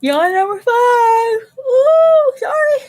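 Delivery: high, startled squeak; surprised squeal. Real, not acted. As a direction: yelp in surprise. Card slips unexpectedly.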